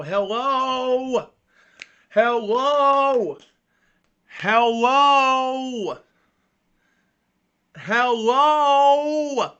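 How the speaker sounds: loud, high drawn-out hello; calling out. Real, not acted. Frozen camera, calling out to listeners, loud. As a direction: shout hello into the frozen stream.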